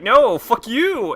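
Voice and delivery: high, loud drawn-out mock curse; retold defiant boss. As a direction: hurl it back, slow and high-pitched. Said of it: "is all like".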